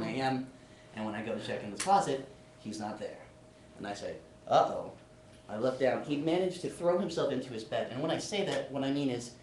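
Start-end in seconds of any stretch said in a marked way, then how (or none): 4.5-4.9 a louder "Uh-oh" of sudden worry; worried uh-oh. Retold or imitated. Discovering the empty closet, a loud worried uh-oh. Finds roommate gone from closet.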